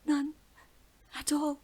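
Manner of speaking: put-on high, breathless girl voice; gasping whisper. A narrator's reading. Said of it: "managed to whisper between great and gasping breaths".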